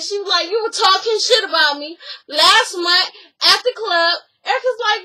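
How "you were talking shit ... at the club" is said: raised, loud accusing tone; mock accusation. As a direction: call her out. Acted quarrel, accusation.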